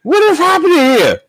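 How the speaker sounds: high, laughing disbelief; incredulous laughter. Real, not acted. Host reacts to a preacher's insults, laughing in disbelief. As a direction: crack up while protesting, pitch way up. Host cracks up at played argument.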